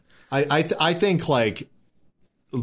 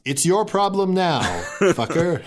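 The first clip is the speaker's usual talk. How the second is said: gleeful taunt; 6 semitones higher than usual. Pretend. Gleeful send-off to enemy.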